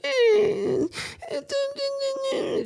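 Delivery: high mock kid whimper; hammed-up childish crying eh; imitated whining cry. Son whining on his birthday, high mock crying. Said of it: "just was fucking crying and whining".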